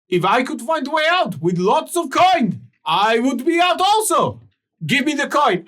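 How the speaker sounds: high, loud and insistent creditor; voice from below. Acted. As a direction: press him to stay and pay. Creditor demands coin.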